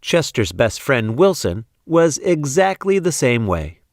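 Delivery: ordinary delivery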